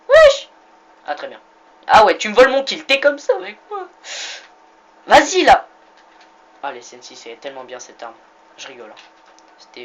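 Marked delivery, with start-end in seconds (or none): 0.1-0.5 a sudden loud high yell; outraged shout. Real, not acted. Gamer yelling at an opponent in the match, loud shout. 1.9-5.7 hammed-up indignation, loud and higher; mock outrage. Real, not acted. Gamer scolding a teammate who stole his kill, mock outrage.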